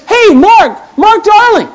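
high, loud hail; calling out. Retold or imitated. Stranger hailing the pastor at the falls, calling out. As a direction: shout a name across a parking lot.

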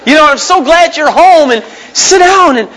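high, slow adoring wife voice; a wife's dreamy welcome home, pitched high; doting wife. Pretend. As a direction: greet him like she's been waiting all day. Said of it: "Just kinda walk in the door and".

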